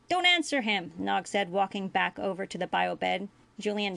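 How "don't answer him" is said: high, louder interjection; urgent Ferengi boy. A narrator's reading. Ferengi boy warns his friend, urgent high voice. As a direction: cut in urgently, pitched up.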